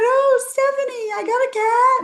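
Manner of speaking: high, bubbly mock-excited voice; imitating the thrilled surrogate. Surrogate telling Stephanie about her new cat, squealing high and excited. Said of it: "she's so excited".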